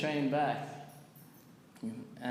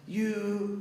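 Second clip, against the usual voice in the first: higher-pitched mock drunk heckle; drunk man swearing; 7 semitones higher than usual. Drunk man accosting chanters on the train, mock swearing. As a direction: lightly imitate an angry drunk. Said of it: "he was swearing at us".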